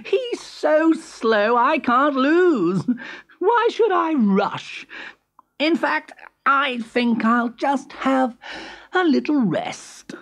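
high, slow, self-satisfied character voice; drawn-out, high, overconfident musing; cocky hare. A narrator's reading. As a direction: muse smugly as the hare, pitched high. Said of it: "thought Hare".

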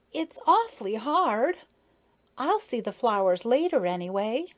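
put-on high, whiny-edged child protest; little child. A narrator's reading. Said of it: "said little Maya".

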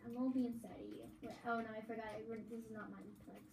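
ordinary talk, nothing marked

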